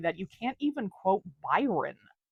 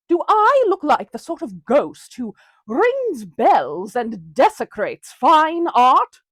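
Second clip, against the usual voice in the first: usual speaking voice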